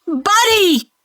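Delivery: piercing high scream of a name; shrieking. A narrator's reading. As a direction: shriek the dog's name in horror. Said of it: "Janet shrieked".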